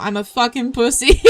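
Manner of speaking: ordinary talk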